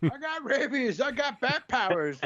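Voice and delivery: high, far-off silly voice; mock superhero. Pretend bat-powered friend, high and silly. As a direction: play a rabid bat hero.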